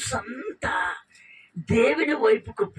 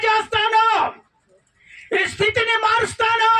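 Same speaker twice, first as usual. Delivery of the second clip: booming promise; 11 semitones higher than usual. Acted. Angel promising Hagar her son will be king, booming vow. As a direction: proclaim it like a vow.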